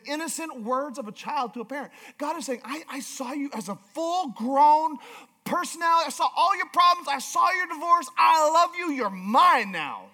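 high, forceful preacher voicing God's love; impassioned preaching. Real, not acted. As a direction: preach it with rising urgency, punching key words.

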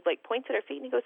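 usual speaking voice

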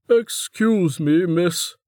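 slow, polite interruption; a hint of a tired guard cutting in; polite minder. A narrator's reading. Minder interrupting Niriri, slow and polite.

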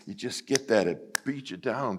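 ordinary talk

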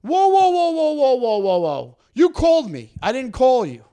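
loud, high mock protest that falls away; indignant outburst. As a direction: cut them off with a blaring objection. Acts out the telemarketer's loud objection.

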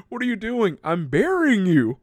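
high, loud comic exclamation; hammed-up exchange, pitched right up; mock outrage. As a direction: exclaim it through amusement. Comic acted exchange while laughing.

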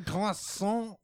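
high, over-French pronunciation; milk the word in mock French; bad French accent. Host savours the vampire's French food word, exaggerated French accent. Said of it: "I can't really do a French accent".

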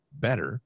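"better" set apart for emphasis; "better" said firmly by itself; stress on better. Real, not acted. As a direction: repeat "better" as a deliberate, isolated word. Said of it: "I wanna stress that word".

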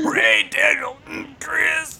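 high, slow, strained growly name call; hammed-up straining announcer; growled announcer intro. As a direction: drag the names out in a mock growl. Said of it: "the little growl to it".

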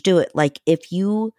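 ordinary talk

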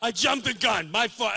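usual speaking voice

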